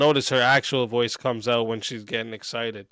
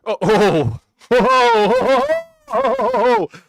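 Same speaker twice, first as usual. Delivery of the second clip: rising outraged oh oh; 10 semitones higher, 5 dB louder than usual. Real, not acted. Building outraged interjection.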